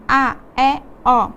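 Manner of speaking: A, E, O each said as a clear open vowel. Shown as a demonstration. Teacher modelling the open vowels for students. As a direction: sound out A, E, O wide open. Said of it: "assim como nós estamos lendo".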